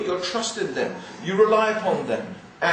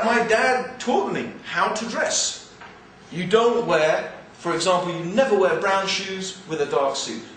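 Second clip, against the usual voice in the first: normal talking voice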